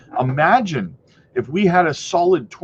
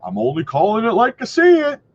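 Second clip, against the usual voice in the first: higher, louder mocking voice of a defensive commenter; mock stubborn commenter; 7 semitones higher, 5 dB louder than usual. Mocking toxic commenters to his viewers, higher and louder. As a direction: parody a commenter who insists he's objective. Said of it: "making comments like".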